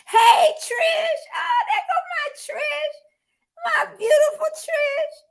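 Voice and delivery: very high, gushing squeal; excited greeting. Real, not acted. Host spotting her friend Trish join, overjoyed.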